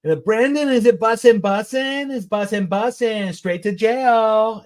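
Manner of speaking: put-on very high, loud child voice, slow at the end; imitating little kid. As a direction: play a cute four-year-old.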